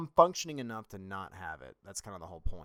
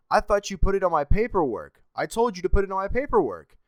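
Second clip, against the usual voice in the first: shouting back; 11 semitones higher, 15 dB louder than usual. Retold or imitated.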